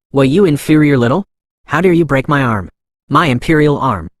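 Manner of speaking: plain reading voice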